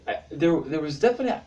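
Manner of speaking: normal talking voice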